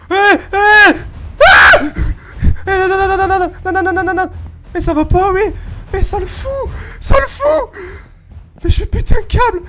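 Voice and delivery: frantic high yelling in terror; panicked screaming. Real, not acted. Jump scare by monster. (hurlements de panique)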